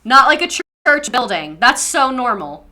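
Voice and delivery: ordinary delivery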